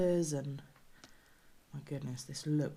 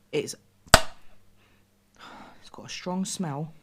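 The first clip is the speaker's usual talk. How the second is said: whispering; 4 dB louder, much slower than usual. Real, not acted. Wary of the strange food.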